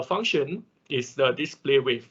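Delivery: ordinary talk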